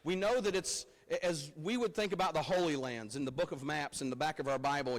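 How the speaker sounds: ordinary delivery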